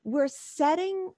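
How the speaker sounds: usual speaking voice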